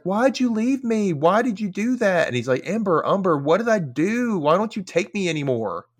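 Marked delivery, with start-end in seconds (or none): 0.0-2.3 put-on pleading child. Martin begging at closet door. 2.7-5.9 put-on pleading child. Martin pleading to Umber.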